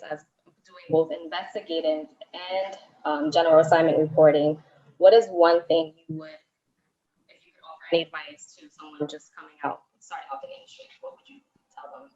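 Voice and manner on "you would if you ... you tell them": fading out on a bad line; distant, dropping-out voice; far from mic. Real, not acted. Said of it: "we lost the last part".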